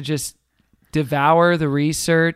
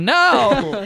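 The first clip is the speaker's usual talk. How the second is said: mock dismay; 6 semitones higher than usual. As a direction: shoo off an unwanted guest. Acted refusal to imagined guest.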